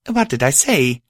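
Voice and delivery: a touch of alarm in the question; frantic. A narrator's reading. Friend alarmed that she caused fresh tears, frantic. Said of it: "I thought frantically".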